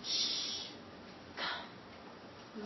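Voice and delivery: put-on breathy whisper, coaxing the girl closer; whispered hush. Secretary hushing Jane beside her sleeping father, whispered.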